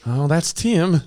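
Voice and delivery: high, deadpan wife's shrug of a line. Retold or imitated. The dead-eyed wife brushing off her husband, high and deadpan. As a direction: toss it off like a resigned wife.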